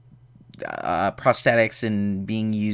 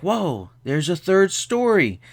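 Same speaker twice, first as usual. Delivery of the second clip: drawn-out, higher-pitched whoa of discovery; mock amazement; 7 semitones higher, slower than usual. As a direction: play up a slow, wide-eyed surprise. Acts out character's amazed discovery.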